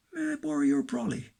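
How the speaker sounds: high, put-on questioning voice; high poetic punchline in a character voice; voiced polite request. Voiced question closing the poem.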